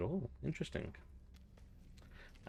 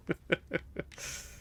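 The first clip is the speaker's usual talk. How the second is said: breathy, trailing giggles; real chuckles dying away; laughing; 9 semitones higher, 4 dB quieter than usual. Real, not acted. Player getting over strange face, laughing. Amused by character's odd face.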